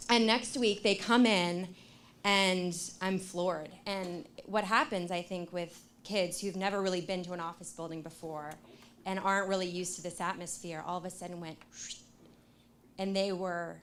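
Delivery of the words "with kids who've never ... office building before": ordinary talk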